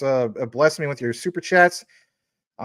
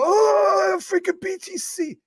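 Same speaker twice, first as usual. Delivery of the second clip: much higher, squealing panicked principal; Principal McVicker; slower than usual. Retold or imitated. Streamer mimics the panicky principal once more. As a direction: freak out in a high cartoon voice. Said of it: "always freaking out".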